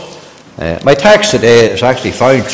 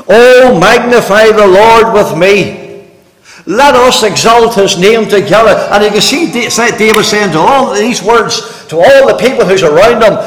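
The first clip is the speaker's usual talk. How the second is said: fervent preaching; 7 semitones higher than usual. Real, not acted. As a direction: drive it home to the congregation. Preaching at full force.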